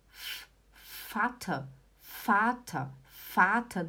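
isolated soft F, then "fata"; F sound demo. Shown as a demonstration. Teacher showing how German V sounds, drilled F pronunciation. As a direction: hiss a bare F, then say "fata" with F. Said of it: "ele faz som de f".

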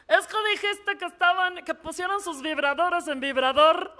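a laughing bystander explaining the blunder; other person explaining. Retold or imitated. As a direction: play the person who tells her the mistake. Someone explaining her slip.

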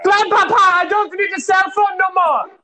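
calling out high. Acted. Hansel shouts to grandpa.

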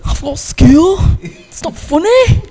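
loud, high-pitched mock Cockney; exaggerated Cockney. Playing up the accent to friends' teasing, high shouted Cockney. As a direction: shout it in a hammed-up Cockney accent, drawn out at the end.

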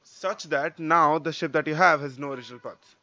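usual speaking voice

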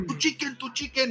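high mock shout of "Tu chicken"; hammed-up mom yelling. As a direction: imitate mom yelling at the counter. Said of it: "mi mamá gritando".

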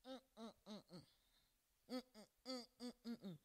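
very faint, closed-mouth "mm, mm, mm"; muffled mental muttering. Retold or imitated. Preacher mimics someone rebuking the devil only in their mind, muffled muttering. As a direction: hum wordlessly, barely audible, imitating silent speech. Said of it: "in your mind you are saying".

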